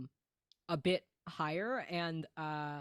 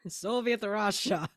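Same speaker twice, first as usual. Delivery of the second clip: hammed-up Russian accent, laughing; a cartoon Soviet villain, half-giggling; mock Russian accent; 4 dB louder than usual. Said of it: "this is the voice that I am doing".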